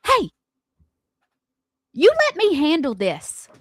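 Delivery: mock-firm order, imitating him; imitating husband. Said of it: "And he goes".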